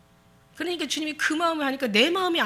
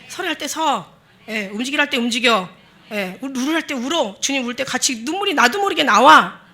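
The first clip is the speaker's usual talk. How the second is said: louder and louder, rising to a cry; building preaching; 10 dB louder than usual. Real, not acted. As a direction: drive it home from the pulpit. Preaching at full force. (점점 고조되는 설교 외침)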